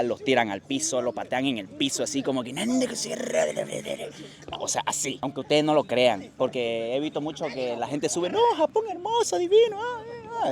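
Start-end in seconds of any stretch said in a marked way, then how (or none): 2.5-3.5 jefe japonés gritando. Retold or imitated. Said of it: "les grita". 8.3-10.5 voz aguda y fingida de burla; burla de fan de Japón. Retold or imitated. Burla de quien idealiza Japón.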